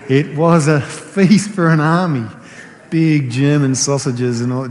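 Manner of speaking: excited. Real, not acted. Excited recalling of huge lunch.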